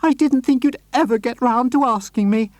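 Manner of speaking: lady thrilled by the proposal; delighted surprise. Acted. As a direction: coo in happy surprise. Accepting proposal happily.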